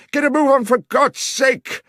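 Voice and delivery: loud, high desperate yell; urgent shout. A narrator's reading. Watson shouting at Holmes before the train arrives, frantic yell. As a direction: yell over the rumble of the train.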